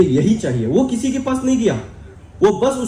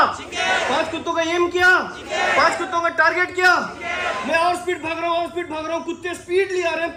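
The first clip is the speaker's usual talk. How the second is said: high, driving storyteller's patter; excited chase narration; 8 semitones higher, faster than usual. Acted. Speaker running from five dogs, excited high patter. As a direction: pitch it high and relentless, like a chase.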